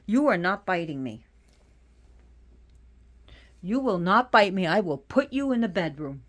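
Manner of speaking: loud, stern telling-off of the cat; scolding the cat. Real, not acted. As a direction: warn the biting cat firmly.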